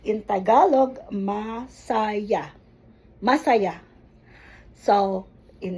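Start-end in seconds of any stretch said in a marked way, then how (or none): 1.1-2.6 "masaya" drawn out slowly in separate syllables. Shown as a demonstration. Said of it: "make it slowly so that". 3.2-3.8 "Masaya" said briskly as one word; faster, joined up. Shown as a demonstration.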